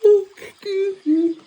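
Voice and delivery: said through a yawn. Real, not acted. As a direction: stretch it out mid-yawn. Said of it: "I just yawned".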